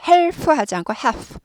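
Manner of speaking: Half said hahf with the L dropped, slowly; silent l in half. Shown as a demonstration. English teacher showing students the silent L, slow demo. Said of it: "L이 묵음이죠".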